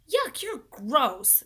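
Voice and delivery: slow, loud disgusted voice. A narrator's reading. Leslie tells Paul he is gross, slow and disgusted. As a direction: drag out the yuck.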